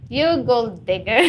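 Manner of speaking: teasing through a real laugh; gold digger said mid-laugh. Real, not acted. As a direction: laugh it out as you tease.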